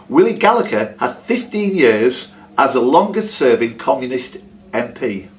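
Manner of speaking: normal talking voice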